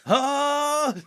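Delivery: loud, very high mock battle cry; powering-up scream. Player yelling into a voice-activated arcade game, mock screaming. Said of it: "Everyone just screaming".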